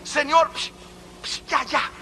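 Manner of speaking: high, urgent plea to stop; acted frantic hushing; panicked shushing. Man frantically shushes God.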